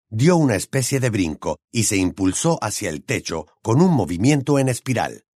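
ordinary delivery

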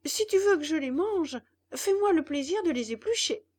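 a hungry puppet complaining to his father; puppet boy voice. Acted. Spoiled puppet demands peeling.

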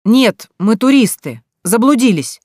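loud, high, stretched-out call; shouting back. A narrator's reading. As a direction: call your answer across a distance. Tourists shout their answer through fog. (громко кричит в ответ)

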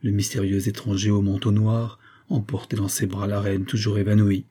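plain reading voice

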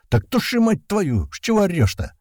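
very high, exasperated snapback; high-pitched annoyed outburst; irritated retort. A narrator's reading. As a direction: snap back at the one yelling. Irritated retort to fire alarm.

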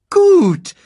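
high, quick cartoon meerkat; chirpy little sidekick voice. Timon speaks.

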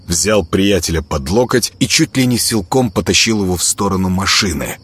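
usual speaking voice

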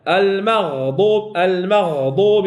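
المغضوب drawn out slowly, each sound clear; slow recitation demo. Shown as a demonstration. Tajweed teacher modelling the word for a student, slow and clear.

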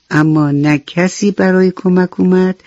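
ordinary delivery, nothing marked

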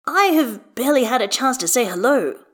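protesting. A narrator's reading. Gale answers his pestering daughter, mildly protesting. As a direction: defend yourself to a nagging child. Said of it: "Gale protests".

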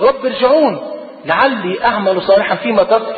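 ordinary talk, nothing marked

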